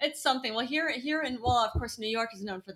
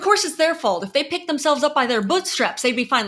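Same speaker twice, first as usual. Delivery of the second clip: raised, louder mock-righteous voice; sarcastic mock blame; 4 semitones higher, 6 dB louder than usual. Host mocking those who blame the homeless, sarcastic. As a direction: parody someone blaming the homeless.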